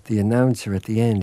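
normal talking voice

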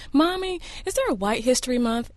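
imitated young daughter. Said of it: "I have a six-year-old daughter".

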